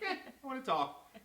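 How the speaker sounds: put-on high, childish "I wanna talk"; whiny plea. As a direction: whine it like a kid who won't let go. Himself whining to wife.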